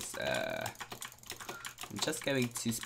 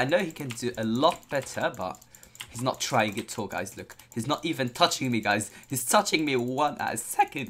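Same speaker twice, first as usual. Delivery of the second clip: ordinary talk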